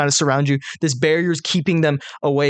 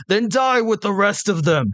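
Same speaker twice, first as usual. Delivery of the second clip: hobgoblin; 7 semitones higher than usual. Acted. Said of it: "look down and say".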